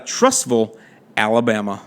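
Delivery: Trussville with a swallowed second syllable, like "vul"; local pronunciation. Retold or imitated. Host introduces the caller from Alabama, Trussville said the local way. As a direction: say Trussville as locals do, dropping away softly on Alabama. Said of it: "he pronounces it Trussville".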